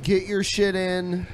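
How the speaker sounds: ordinary talk, nothing marked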